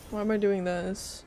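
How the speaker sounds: ordinary delivery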